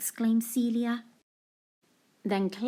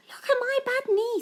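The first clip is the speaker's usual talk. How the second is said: put-on high little girl voice; high-pitched child's "look"; child showing knee; 13 semitones higher than usual. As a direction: show off the knee like an excited child. Claire's repeated line.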